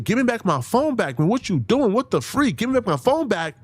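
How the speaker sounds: high-pitched mock yell at normal volume; retold scream. Arguing what Nolan screamed for his phone, mild mock scream. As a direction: imitate an urgent screamed demand, without real shouting. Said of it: "Because if he's screaming".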